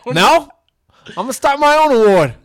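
loud, high-pitched comic proclamation; mock defiant shout. Announcing his own award to the absent rival, mock-defiant shout. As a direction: declare it at full volume, playing defiant.

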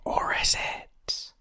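slow, soft whispered question; mock-mysterious whisper. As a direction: whisper it slowly to cast playful doubt. Teasing mock-mysterious doubt after announcement.

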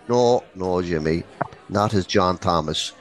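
normal talking voice

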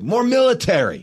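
high, loud barked adjective; barked direction. Retold or imitated. Director barking notes at the actors, loud bark. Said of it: "to just bark out adjectives".

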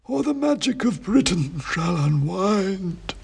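high, slow, dusty oracle voice; dusty faint ancient head. A narrator's reading. Ancient king's head answering the wizard, slow high dusty chant. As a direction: intone it like a faint, dusty myth. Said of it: "said Bran".

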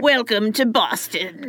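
put-on slow pirate-style greeting posing as Boston accent; joke pirate accent. Joking about a Boston accent, slow pirate voice. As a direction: drag out a mock pirate welcome. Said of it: "the Boston accent".

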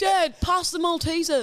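high-pitched mock child voice; hammed-up squeaky casual request; awkward kid. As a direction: ask dad for snacks like a kid. Playing kid at awkward family film.

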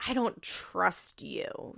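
whispered, slow and hushed; child's hushed accusation, whispered slowly; quiet slow whisper. Retold or imitated. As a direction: breathe it out like a hurt child. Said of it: "And then Gracie says".

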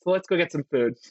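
understated friend's suggestion, in an even voice; consoling friend. Acted. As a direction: casually offer it to someone upset. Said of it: "the person talking to them is like".

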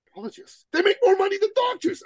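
very high-pitched, hammed-up excitement; excited mock brainwave. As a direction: imitate a client thrilled by his idea. Client's foolish bright idea, mocked.

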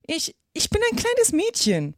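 high-pitched, halting start; somewhat unsure. A narrator's reading. Alice claiming to be a girl, unsure. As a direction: stammer the first word, doubting yourself. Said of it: "sagte Alice etwas unsicher".

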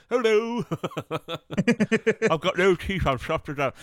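high, loud mock softie; laughing through a soppy voice. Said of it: "he walks around like".

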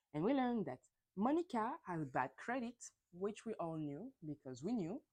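normal talking voice

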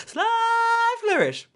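high held name. Acted. Reads a name dramatically.

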